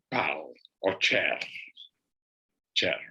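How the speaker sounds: normal talking voice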